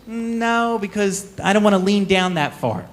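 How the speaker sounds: lightly voiced warrior who can't be bothered; mild whine about leaning down; lazy warrior refusing.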